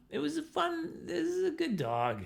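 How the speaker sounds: high, sing-song voice for praising a dog; cutesy dog praise. Retold or imitated. Owner praising her good dog, high and cooing. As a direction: coo at the dog like a pet owner.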